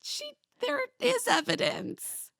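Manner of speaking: very high imitated objection; hammed-up high-pitched protest; squeaky mock protest. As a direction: squeak out a feeble retort. Acted retort to the retold accusation.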